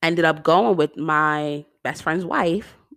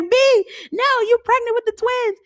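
mock fan protest; 3 dB louder than usual. Voicing fans reacting in audience.

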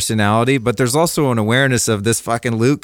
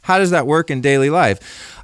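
ordinary talk

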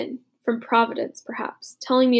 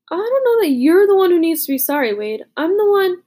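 put-on higher, louder rueful young soldier; Butch voiced; 7 semitones higher, 6 dB louder than usual. A narrator's reading. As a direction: protest the apology, pitched up. Butch admits his fault.

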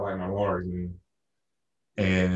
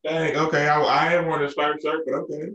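high-pitched, louder, playfully wounded self-quote; a higher, hammed-up "well, fine then"; mock deflated; 8 semitones higher, 5 dB louder than usual. Narrator quoting himself after the comic shut him down, mock deflated.

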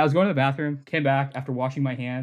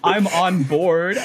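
laughing; 3 semitones higher, 5 dB louder, much slower than usual. Real, not acted. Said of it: "I am also on board".

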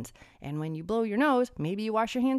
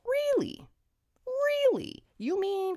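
much higher incredulous really; sarcastic disbelief; 14 semitones higher, slower than usual. Acted. Vlogger scoffing at the bill calling the database confidential, in mock disbelief. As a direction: drag it out as if you can't believe it.

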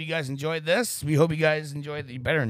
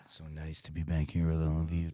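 deep put-on voice; 10 semitones lower, 4 dB quieter than usual. Mock deep voice bit.

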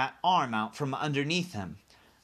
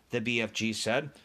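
ordinary delivery, nothing marked

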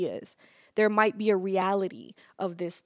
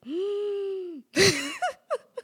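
drawn-out mock gasp; 11 semitones higher than usual. Reacting to son's complaint.

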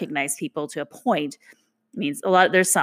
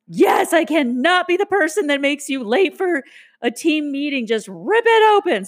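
urgent; 9 semitones higher, 3 dB louder than usual. Retold or imitated. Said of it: "And I was like".

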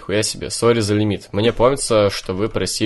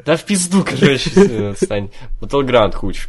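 loud exasperated give-up; storming off shouting; 4 semitones higher, 6 dB louder than usual. Real, not acted. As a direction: throw up your hands, louder. Host gives up, storming off.

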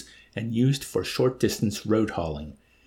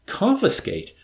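Confiscate! spat out higher and louder; indignant exclamation; 9 semitones higher, 3 dB louder than usual. A narrator's reading. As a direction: fling the word Confiscate! in outrage. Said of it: "The mere word was anathema".

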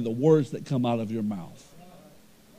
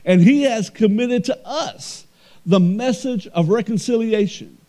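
loud, high, slow preacher's cry; booming pulpit delivery; full-force preaching; 8 semitones higher, 7 dB louder than usual. Acted. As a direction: preach it out to the whole church.

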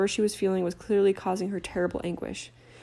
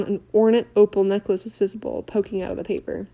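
plain reading voice

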